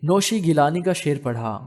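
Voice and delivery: ordinary delivery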